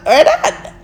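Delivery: "We're done" up high through a giggle; laughed repeat of "We're done" after the plain one; laughing. Real, not acted. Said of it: "yeah, we're done".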